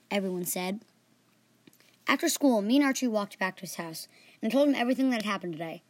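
usual speaking voice

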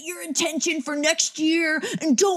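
high, lecturing voice; mock the preachy New Year posts. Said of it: "people yelling at everybody".

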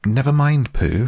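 high-pitched, impatient interruption. A narrator's reading. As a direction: cut in impatiently, pitched well up. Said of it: "said Piglet impatiently".

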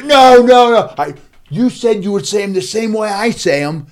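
high, loud objection over the crowd; hammed-up indignant squawk; mock outraged protest. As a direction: shout them down playfully. Acts out protest at the kids.

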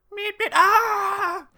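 slow, high, loud cartoon meep and cry. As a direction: squeak it out like a cartoon. Silly noise after hat joke.

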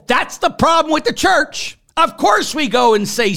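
high-pitched, impassioned outburst; heated preaching. Real, not acted. Priest naming the problem with the church, heated. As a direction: burst out in exasperated conviction. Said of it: "I get a little hot".

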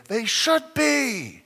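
they should be, slow, high and loud; drawn out emphasis. Acted. Preacher stressing how things should be, slow and high. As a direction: stretch each word out high and slow.

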